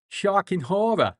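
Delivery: hammed-up Canton soccer mom accent. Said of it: "with a Canton soccer mom accent".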